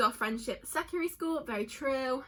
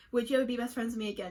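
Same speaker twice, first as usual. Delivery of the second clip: ordinary talk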